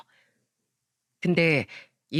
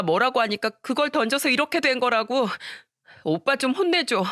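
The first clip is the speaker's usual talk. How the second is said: high, whiny, tearful pleading; a hurt girl begging for justice; tearful daughter; 5 semitones higher than usual. Retold or imitated. Hurt daughter tearfully begging her mother to scold her brother. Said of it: "엉엉 울면서 이야기를 했다고".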